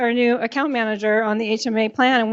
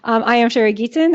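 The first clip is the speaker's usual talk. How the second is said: usual speaking voice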